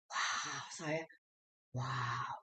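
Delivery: awed wow. Real, not acted. Awed at eternal love.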